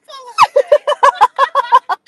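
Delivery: high loud burst of laughter; delighted high laughter at the friend. Real, not acted.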